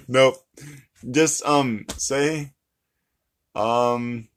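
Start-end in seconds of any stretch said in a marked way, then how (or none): none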